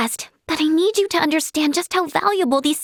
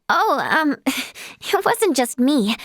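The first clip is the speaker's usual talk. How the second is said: ordinary talk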